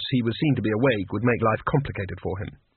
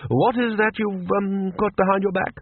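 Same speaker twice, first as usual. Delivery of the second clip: put-on high, booming old gentleman; jolly old don greeting; hearty Arch-Chancellor; 7 semitones higher, slower than usual. A narrator's reading. Ridcully suspicious.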